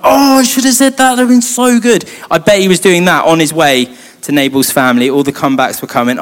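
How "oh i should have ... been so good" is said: high, strained mock lament; hammed-up frustration at a missed chance; exasperated regret. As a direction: groan it high, kicking yourself. Acted regret over a missed comeback.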